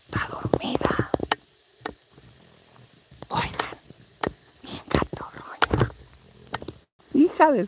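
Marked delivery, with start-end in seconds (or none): 0.1-3.8 soft whisper so she won't wake; whispering beside someone sleeping. Real, not acted. Sneaking near a sleeping woman. 4.6-5.9 slow hushed whisper; whispering over a sleeping woman. Real, not acted. Sneaking near a sleeping woman.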